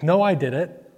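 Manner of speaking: normal talking voice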